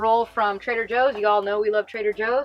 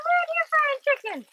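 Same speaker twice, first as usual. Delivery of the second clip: pushed way up, squealing; very high squeal. Real, not acted. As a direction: repeat it even higher and squealing. Repeats even higher.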